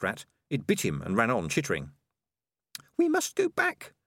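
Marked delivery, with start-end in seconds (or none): 3.0-3.9 very high-pitched, urgent rat. A narrator's reading. Rat urging companions to turn back, high and urgent. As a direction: press them to act, voice very high. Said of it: "said Peaches urgently".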